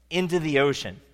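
ordinary delivery

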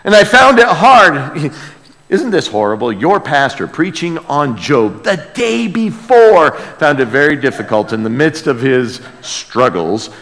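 ordinary talk, nothing marked

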